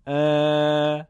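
ا held long and level; long alef. Shown as a demonstration. Teacher contrasting alef maddi with fatha, prolonged. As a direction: stretch ا to double length. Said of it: "الف مدی با اندکی کشش".

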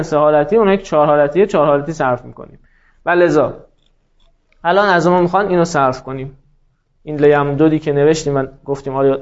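normal talking voice

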